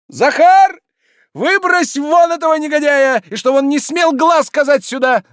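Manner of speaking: very high, full-voiced command; furious shouting. Oblomov orders Zakhar to throw him out, furious shouting. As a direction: roar for the servant at top pitch. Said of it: "закричал Обломов".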